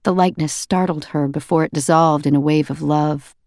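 ordinary delivery

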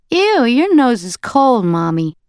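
put-on high, slow little boy voice; toddler voice. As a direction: squeal it like a small child.

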